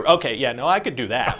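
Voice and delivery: normal talking voice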